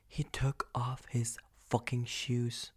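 shocked hushed whisper; whispering in disbelief. Real, not acted. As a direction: lean in and whisper the outrage. Scandalized aside about old man.

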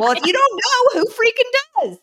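put-on high, loud exasperated cry at the kids; mock fed-up mother yelling; exasperated mom outburst. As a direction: throw up your hands at the kids, loud and high. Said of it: "I'm like".

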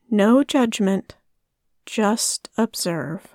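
ordinary delivery

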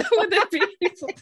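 high, loud, quick words through laughter; laughing. Real, not acted. Host joking with guest about understanding her, laughing. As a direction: barely get it out for laughing.

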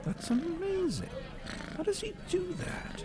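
very high, astonished voice; amazed. Acted. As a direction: gasp it out in wonder. Cat smokes a cigarette.

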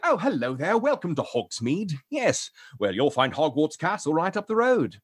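posh British train conductor; welcoming RP British conductor; RP British accent. Acted. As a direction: greet visitors in crisp RP British. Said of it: "definitely an R P British man".

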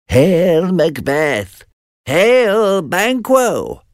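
high eerie witch hails; witches hailing. Acted. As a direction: call both men from the mist. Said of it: "the witches said together".

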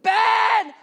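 sharp, high, loud shout of a name; mom's angry yell. Retold or imitated. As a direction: yell it like a furious mom. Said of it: "She says, She's so mad".

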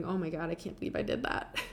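imitating own disbelief with a light laugh; amused self-reproach. Recalling her next-day realization to listeners, amused self-reproach.

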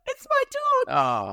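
very high, slow, drawn-out mock wail; retold horrified realisation. Owner recognising her shorn dog by his eyes, high horrified wail.

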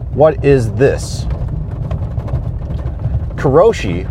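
usual speaking voice

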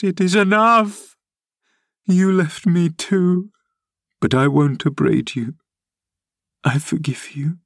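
high, anguished pleading; a tearful, high-pitched outpouring that sinks to a hush and rises; sobbing. A narrator's reading. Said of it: "sobbed Catherine".

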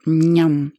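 chewing with a full mouth. A narrator's reading. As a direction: talk through a mouthful. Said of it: "with a mouth so full".

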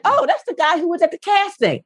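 high, dawning surprise; high mock surprise; naive surprise. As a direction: realise it in a high voice. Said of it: "I was like".

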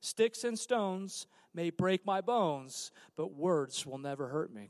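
slow sing-song rhyme recital; slow recitation. Retold or imitated. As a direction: recite it slowly like a childhood rhyme. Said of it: "filled in the rest of the sentence".

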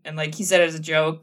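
ordinary talk, nothing marked

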